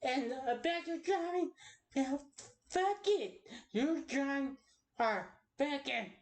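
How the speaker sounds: mock and belittle the child angrily; Voltage's dad. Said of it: "I'll just do it farther".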